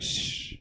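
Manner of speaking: soft, long "shh"; quiet shushing. Acted. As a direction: hush with no voice at all. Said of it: "Usually they're quiet, just like me".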